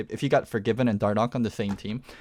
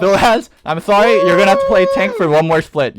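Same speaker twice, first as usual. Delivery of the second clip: loud mock announcement; 9 semitones higher, 15 dB louder than usual. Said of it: "repeated announcement".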